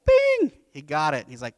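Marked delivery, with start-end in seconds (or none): 0.0-0.5 imitated light-bulb ding; ping it out very high and loud; sudden bright bell-like bing.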